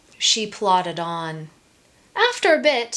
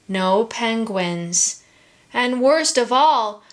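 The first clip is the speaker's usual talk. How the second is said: ordinary delivery, nothing marked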